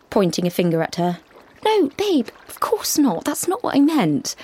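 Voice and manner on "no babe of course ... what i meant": backpedaling. A narrator's reading. Chrissy backpedaling.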